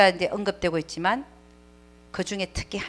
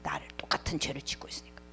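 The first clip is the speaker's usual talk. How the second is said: hushed, breathless whisper; 4 semitones lower, 13 dB quieter than usual. Retold or imitated. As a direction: whisper it like a secret from hell. Describing hell's attacking beast. (지옥 이야기를 속삭이듯)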